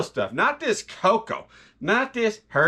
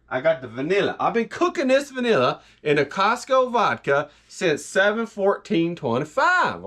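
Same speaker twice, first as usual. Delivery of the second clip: usual speaking voice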